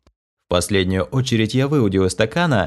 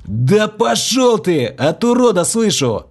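high, loud, slow furious yell; exploded; 11 semitones higher, 4 dB louder, slower than usual. A narrator's reading. Narrator yelling back at a rude caller, furious. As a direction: blow up at the caller. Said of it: "Взорвался я".

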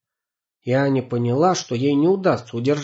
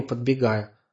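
plain reading voice

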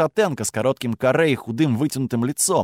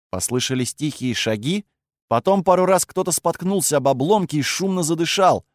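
ordinary delivery, nothing marked

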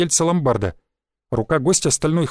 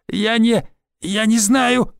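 higher, strained moan in pain; 6 semitones higher, much slower than usual. A narrator's reading. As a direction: stammer it out through the pain. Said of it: "мычал Измаил". (мычание от боли, выше обычного)

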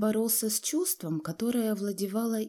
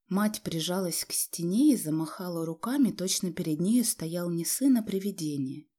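ordinary delivery

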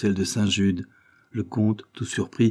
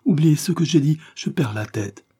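higher and louder plea, fading at the end; despairing cry; 7 semitones higher, 4 dB louder than usual. A narrator's reading. Barbara begs her husband to forget her question, in despair. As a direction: beg him to forget it, then falter. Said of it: "s'écria la jeune femme avec désespoir".

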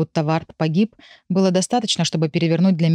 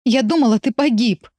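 bursting out in tears; 5 semitones higher than usual. Acted. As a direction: blurt it through sobs. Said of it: "вырывается у меня".

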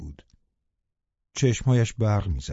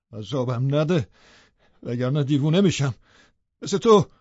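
building to a loud, higher cry at the end; loud through clenched teeth; 3 semitones higher than usual. A narrator's reading. Lover begging the dying woman to stop, rising to a cry. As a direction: grit the teeth, then break out. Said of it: "دندانهایش را به هم فشرد و با صدای بلند گفت".

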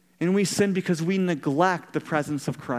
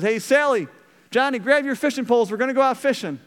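cheery call; 6 semitones higher, 4 dB louder, faster than usual. Retold or imitated. Grandpa calling the kids.

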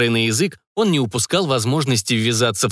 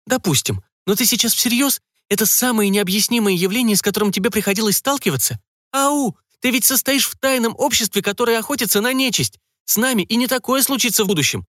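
put-on much higher, teasing young woman; mock her partner's doubts, voice pitched well up; Emily, incredulous; 10 semitones higher than usual. A narrator's reading. Emily teasing Jacques about secret society, high and incredulous.

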